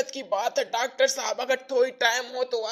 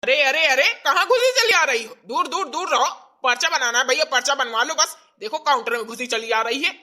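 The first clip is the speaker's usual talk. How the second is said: scolding clerk; 5 dB louder, faster than usual. Acted. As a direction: shoo her out of the counter. Clerk stops intruder at counter. (Counter में घुसी औरत को डाँटता बाबू)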